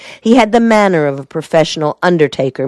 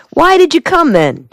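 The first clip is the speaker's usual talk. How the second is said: sharp, much higher demand; 12 semitones higher than usual. A narrator's reading. Della confronts Drake, demanding and much higher. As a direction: demand it, pitched up high. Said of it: "she demanded".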